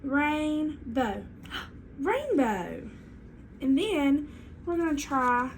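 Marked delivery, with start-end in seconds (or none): 0.0-1.2 "rainbow" slowly split, RAIN... BOW; teacher's slow two-beat "rainbow" before blending it; stretched into syllables. Shown as a demonstration. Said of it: "I'm gonna slowly bring it together". 2.0-2.9 "rainbow" joined smoothly with no gap; both syllables of "rainbow" run together; blended whole word. Shown as a demonstration. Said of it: "I say my whole word again".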